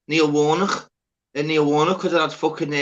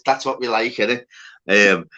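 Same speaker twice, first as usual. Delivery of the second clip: ordinary talk, nothing marked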